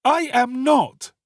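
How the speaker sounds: put-on high, slow, emphatic boy's denial; greatly alarmed. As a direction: protest in alarm, loud and high. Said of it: "greatly alarmed".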